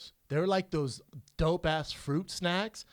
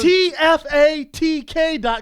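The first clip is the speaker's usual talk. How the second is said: exasperated insistence; 9 semitones higher, 10 dB louder than usual. Real, not acted. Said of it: "exasperated correcting partner".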